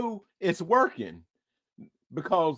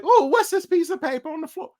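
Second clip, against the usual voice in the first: much higher puzzled question; mock surprise; 11 semitones higher, faster than usual. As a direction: act out spotting the note. Voicing surprised people.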